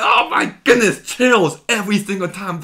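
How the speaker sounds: high and loud, thrilled gasp of delight; gushing excitement. Real, not acted. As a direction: blurt it out, overwhelmed by the scene. Gushing over the finale scene.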